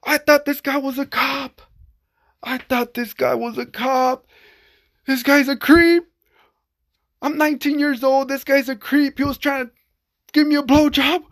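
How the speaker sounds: high-pitched, worked-up retelling; outraged disbelief. Real, not acted. Telling viewers about the creep, high-pitched outrage. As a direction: still reeling as he tells it, voice up high.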